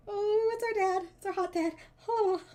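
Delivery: real swoon over the hot dad; gushing delight. Real, not acted. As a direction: coo it like you're smitten. Fawning over character art.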